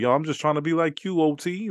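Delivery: high playful gassing; joke-flatter an old man; hyped-up flattery. Retold or imitated. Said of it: "I'd say some shit like".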